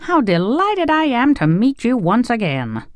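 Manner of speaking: high-pitched, delighted welcome; joyful. A narrator's reading. Tin Woodman welcoming the Scarecrow back, joyful. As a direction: greet a dear friend with high-voiced joy. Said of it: "cried the Tin Woodman joyfully".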